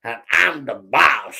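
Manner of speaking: slow, boastful frog boss; bullying big frog. Acted. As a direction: drag out each word as a cocky bully. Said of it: "He was a bit of a bully".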